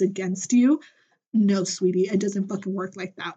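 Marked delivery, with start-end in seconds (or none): none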